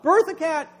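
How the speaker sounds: high, loud order to the dog; mock loud command. Preacher ordering the dog, high loud command.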